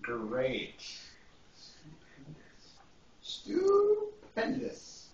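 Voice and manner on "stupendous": Stupendous stretched long and high; drawn-out stupendous. Shown as a demonstration. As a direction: savour Stupendous slowly, pitch up high. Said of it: "That's a fancy word for".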